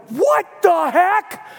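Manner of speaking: stunned by what he found online; shocked outburst. Retold or imitated. Shocked at Googling friend.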